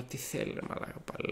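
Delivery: very soft, voiceless grumble; retold sleepy grumble. Woken student sees phone ring again, muted grumble. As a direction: grumble under your breath, re-enacting it.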